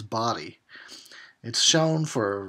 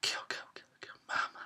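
eerie whisper, much softer; mock horror whisper; 11 dB quieter, faster than usual. Imitating the movie's killer sound cue as a whisper. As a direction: breathe it like the killer's echo.

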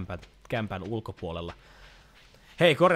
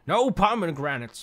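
slow, louder mock yell of the meme teacher; meme teacher shout; 7 semitones higher, 5 dB louder, slower than usual. Gamer quoting the pomegranate meme, slow mock teacher yell. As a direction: bellow it like the meme teacher, drawn out. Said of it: "missä joku opettaja vaan huutaa".

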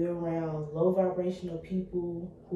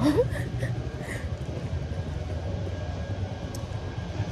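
breathless laugh; 13 semitones higher than usual. Real, not acted. Escalator view overwhelms her.

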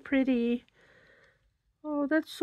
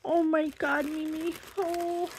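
high, soft, choked-up gasp; moved almost to tears by a gift; emotional; 5 semitones higher, 5 dB quieter than usual. Real, not acted. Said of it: "I'm emotional today".

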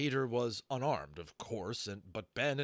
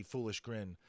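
usual speaking voice

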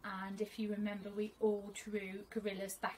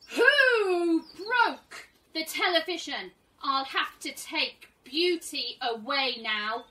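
slow, much louder angry shout with a cry in it; 7 semitones higher, 15 dB louder, much slower than usual. Acted. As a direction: bellow it out, furious and upset. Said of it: "became more and more upset, and then very angry".

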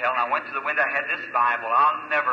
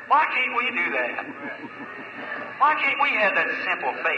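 fervent preaching; 5 semitones higher than usual. Acted. Preacher urging the congregation toward simple faith, fervently. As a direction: preach it, rising with conviction.

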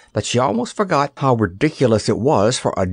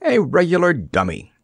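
higher-pitched cheerful statement; 6 semitones higher than usual. A narrator's reading. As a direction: announce it contentedly. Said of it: "insisted the stuffed king cheerfully".

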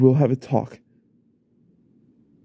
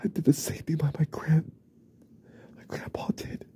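whispered confession; hushed guilty whisper; shaken whisper; 3 semitones higher, 4 dB quieter than usual. Acted. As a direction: whisper it as the truth sinks in. Confession to himself.

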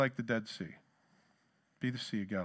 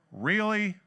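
higher, louder exasperated "Really?"; fed up with the world; 6 semitones higher, 4 dB louder than usual. Retold or imitated. Keys fall a second time, exasperated outburst. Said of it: "I'm like".

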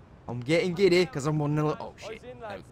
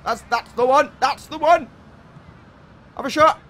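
excited; 9 semitones higher, 8 dB louder than usual. Real, not acted. Excited calling.

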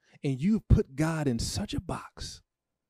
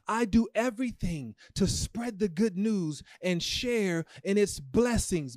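preaching; 7 semitones higher, slower than usual. Acted. Preaching the corrected verse.

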